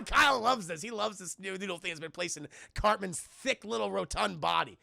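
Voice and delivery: usual speaking voice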